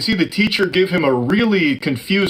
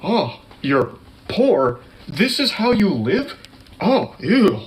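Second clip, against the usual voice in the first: put-on slow, higher sneering rich-kid voice; snobby disgust; 6 semitones higher than usual. Host parodying the YouTuber's disgust at poverty, slow snobby sneer. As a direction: sneer down at them, slowly. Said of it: "him going".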